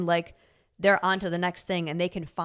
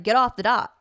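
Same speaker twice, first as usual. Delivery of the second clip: mock bossy order, louder and higher; rude kid command; 4 semitones higher, 7 dB louder than usual. Kid ordering another child off the dot, rudely. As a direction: blurt it as a blunt, loud order. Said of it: "she easily could have been like".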